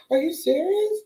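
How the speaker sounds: high incredulous question; can't believe it; high rising are you serious; disbelief. Retold or imitated. Retelling shocked reply.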